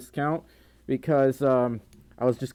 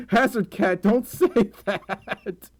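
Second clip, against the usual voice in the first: high, drawn-out words through laughter; laughing protest; 7 semitones higher, 4 dB louder, slower than usual. Real, not acted. Streamer to a teasing viewer, laughing protest. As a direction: scold while cracking up, louder and slower.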